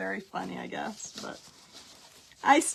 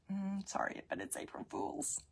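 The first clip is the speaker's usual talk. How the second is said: much softer, teasing reveal; soft gotcha; 12 dB quieter than usual. Retold or imitated. Mom quietly reveals the prank to her daughter. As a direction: drop it gently as the punchline. Prank punchline delivered softly.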